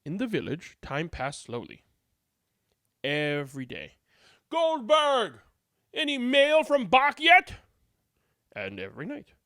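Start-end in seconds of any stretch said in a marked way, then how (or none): 4.5-7.6 high, loud villager call; nagging villager, higher and louder. A narrator's reading. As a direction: shout the question across the village. Villager calls to Goldberg.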